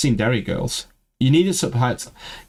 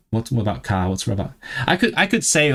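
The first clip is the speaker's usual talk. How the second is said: ordinary delivery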